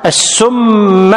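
السم drawn out slowly as "as-summa"; doubled sīn and mīm. Shown as a demonstration. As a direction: hold both the s and the m of السم. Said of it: "بتشديد السين والمي-، والميم".

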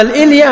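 "الإلية" said with i, il-ilya; "الإلية" with an i at the start, il-ilya; mistaken form. Shown as a demonstration. Teacher shows the wrong word: "الإلية" with i. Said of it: "غلط".

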